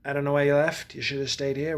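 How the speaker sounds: ordinary talk, nothing marked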